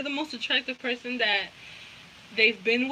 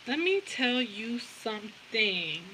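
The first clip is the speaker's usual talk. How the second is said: each word drawn out and softly stretched; slow, deliberate, savouring every word; slow, emphatic; 6 dB quieter, slower than usual. Real, not acted. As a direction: stretch "Let me tell you something" word by word.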